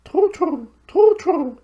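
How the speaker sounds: very high owl voice, hooting the words sadly; a sorrowful hoot; high owl hoot with a sad fall. Acted. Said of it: "sadly shaking its big head".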